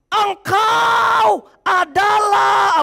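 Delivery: very slow drawn-out demon shout; demon's cry. Acted. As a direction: stretch it out like a demon crying out. (teriakan iblis yang sangat lambat)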